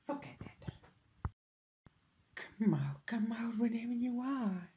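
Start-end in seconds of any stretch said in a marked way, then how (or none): 2.4-4.7 soft, breathy sing-song lure; taunting whisper. Acted. Stalking Ethan through the castle, taunting whisper. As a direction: coax him out of hiding in a whisper.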